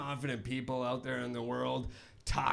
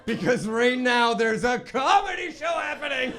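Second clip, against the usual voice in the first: loud, high shout through laughter; pitched-up comic holler; mock scolding shout; 9 semitones higher, 8 dB louder than usual. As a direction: yell it at the crowd, amused. Shouting over own confession, laughing.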